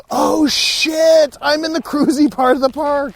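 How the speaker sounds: loud, high mock alarm; retold shocked realization. Man retelling finding himself in the cruisy part, mock shock.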